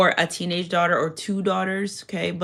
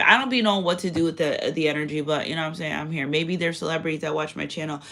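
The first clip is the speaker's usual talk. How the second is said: usual speaking voice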